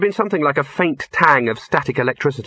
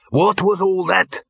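gruff barman; 7 semitones higher, slower than usual. A narrator's reading. Angry owner Harger.

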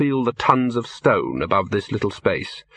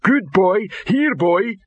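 high troll voice, coaxing a dog; high-pitched rocky troll calling a pet; 9 semitones higher, slower than usual. A narrator's reading. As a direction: call the dog over, pitched well up. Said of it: "said the voice of rock".

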